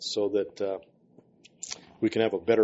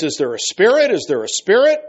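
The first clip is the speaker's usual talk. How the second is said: raised, higher voice building louder; demanding interrogation; 7 semitones higher, 7 dB louder than usual. Acted. Healer questioning a demon in an exorcism, demanding. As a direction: call out the question insistently.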